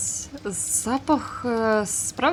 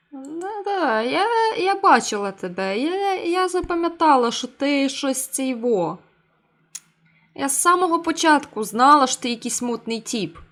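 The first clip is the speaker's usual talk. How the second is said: excited; 5 semitones higher, 6 dB louder than usual. Acted. Game character excitedly accusing.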